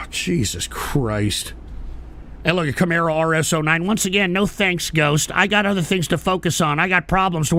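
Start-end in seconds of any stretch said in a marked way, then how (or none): 0.1-1.5 low, slow, soft groan of words; weary exasperation. Real, not acted. Host worn down by his callers, weary exasperation. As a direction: sigh it out, drained and fed up. Sighs, fed up with chat.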